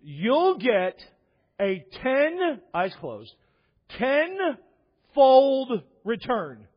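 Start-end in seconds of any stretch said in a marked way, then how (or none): 0.0-2.6 high, loud mock prophecy; hammed-up, halting preacher promise; imitated televangelist. Televangelist promising money with eyes closed, hammed-up revelation. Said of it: "doing it with his eyes closed". 4.0-6.7 very slow, high, loud proclamation; imitated televangelist. Televangelist promising a widow her return, slow hammed-up proclamation. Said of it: "doing it with his eyes closed".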